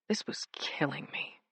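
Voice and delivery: hushed, despairing whisper. A narrator's reading. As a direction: breathe it out in despair. Narrator's despairing aside.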